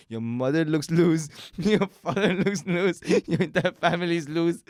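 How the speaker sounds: high, slow, drawn-out insult through laughter; laughing mock insult. Friend retelling a guy's botched insult, laughing mock taunt. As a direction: deliver each insult slowly and loudly, cracking up between them.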